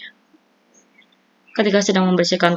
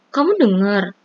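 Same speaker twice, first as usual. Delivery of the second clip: embarrassed; 3 semitones higher, slower than usual. A narrator's reading. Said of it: "Ia semakin malu".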